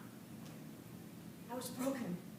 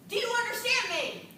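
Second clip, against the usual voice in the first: drill sergeant; 3 semitones higher, 6 dB louder than usual. Retold or imitated. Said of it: "she spoke like a drill sergeant".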